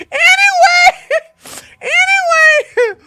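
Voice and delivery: very high, loud squeal through laughter; real laughing fit on the word; helpless laughter. Real, not acted. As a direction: try to carry on while cracking up. Laughing too hard to resume story.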